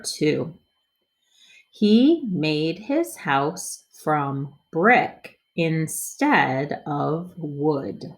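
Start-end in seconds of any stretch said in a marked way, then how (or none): 1.7-8.0 slow, word by word; slow choppy reading. Shown as a demonstration. Teacher models first slow read for kids. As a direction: read each word apart, slowly. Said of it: "Let's read it more smoothly".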